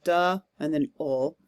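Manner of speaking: "double" split into "duh" and "ul", with a gap between; syllable by syllable. Shown as a demonstration. Teacher marks the syllable division for students, syllable by syllable. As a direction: sound out "duh", then "ul", as separate syllables. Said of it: "for the accented syllable".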